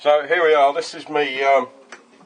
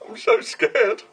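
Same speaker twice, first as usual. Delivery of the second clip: squeaky high mock fright, far higher; mock scared; 12 semitones higher, slower than usual. Joking to viewers about the silencer, mock terrified. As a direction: play at being frightened, hammed-up. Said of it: "I'm terrified of this".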